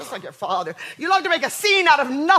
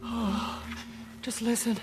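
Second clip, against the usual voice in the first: dreamy, faraway; 2 semitones lower, 7 dB quieter than usual. Acted. Mother drifts off dreamily.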